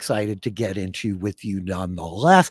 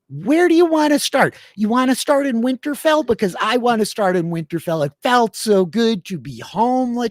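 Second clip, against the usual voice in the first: excited; 10 semitones higher, 4 dB louder than usual. Real, not acted. Thrilled to return to Winterfell.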